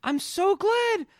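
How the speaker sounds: high, loud shrieking mother; hysterical mom. Retold or imitated. Host imitating the mother's hysterical greeting, high shriek. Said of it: "when she's like".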